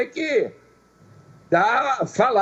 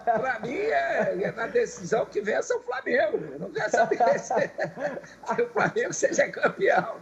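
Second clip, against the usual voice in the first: laughing; 2 semitones higher, 3 dB quieter than usual. Real, not acted. Laughing through his answer.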